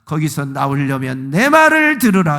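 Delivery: God's voice pleading loudly with his people; crying out. Retold or imitated. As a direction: call out to the people, begging. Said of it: "외치는 거예요".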